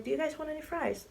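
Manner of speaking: high, sweet friendly offer; [name] repeating the offer, high and sweet. Retold or imitated. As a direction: ask again, lightly and kindly. [name] offers fries again.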